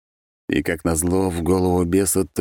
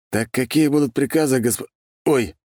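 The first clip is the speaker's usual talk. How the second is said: higher voice cut off by a yelp; startled cry; 8 semitones higher than usual. Acted. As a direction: break off with a sudden ouch. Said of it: "вдруг вскрикнул Генри". (испуганный вскрик)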